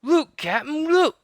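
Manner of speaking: very high, loud thrilled call; excited crewman. Acted. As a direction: call out in glee.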